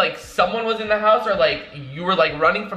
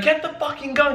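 high-pitched mock yell; imitated scream; 7 semitones higher than usual. Imitating Brandon demanding the gun, mock screaming. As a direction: mimic someone yelling an order, pitched up. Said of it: "he's screaming and he's like".

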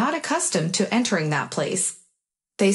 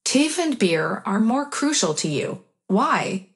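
ordinary delivery, nothing marked

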